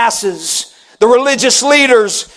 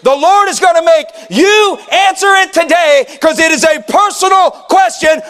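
fervent preacher, raised and driving; preaching at full force; 6 semitones higher than usual. Acted. Pastor pressing the church with a fervent call. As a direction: press the question on the congregation.

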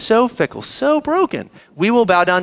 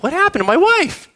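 puzzled husband; 12 semitones higher than usual. Retold or imitated. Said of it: "he's like".

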